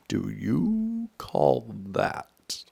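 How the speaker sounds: loud, very slow, drawn-out protest; outraged cry. A narrator's reading. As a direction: cry out as the startled poor king. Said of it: "cried the ki-, poor king".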